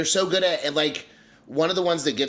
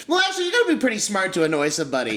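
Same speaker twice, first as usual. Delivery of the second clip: high-pitched, rushed know-it-all parody; mocking imitation; 8 semitones higher, 4 dB louder, much faster than usual. Mocking co-host for arguing with him, high mocking imitation. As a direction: mimic a pedantic contrarian, high and fast. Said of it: "You're like".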